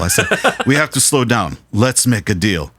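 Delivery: hammy accented producer impression; put-on foreign accent, deliberately spoken; Giorgio. Producer bargaining with the drummer, put-on accented voice. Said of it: "should I do the Giorgio voice?".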